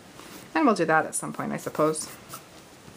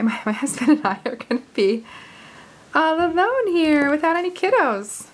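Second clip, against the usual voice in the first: rising into a high, louder, gleeful delivery with laughs; real excited giggliness breaking through; giddy excitement; 8 semitones higher, 4 dB louder than usual. Real, not acted. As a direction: build giddily to a louder, higher peak. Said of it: "I'm kind of excited about it".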